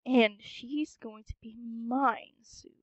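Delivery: hushed, scheming whisper; sinister whisper. Acted. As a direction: whisper it like a secret plot. Villain gloats about Katie.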